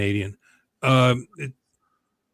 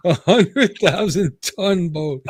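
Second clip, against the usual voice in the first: mocking himself; 6 semitones higher, 4 dB louder, much slower than usual. Real, not acted. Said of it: "That's pretty funny".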